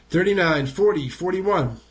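ordinary talk

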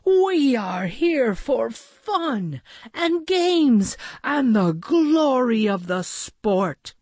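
grand, slow, high-pitched proclamation; calling out. A narrator's reading. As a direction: proclaim it to the crowd with drawn-out words. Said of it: "Maxwell called out".

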